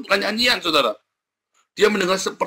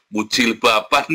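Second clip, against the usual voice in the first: ordinary delivery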